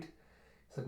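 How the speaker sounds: ordinary delivery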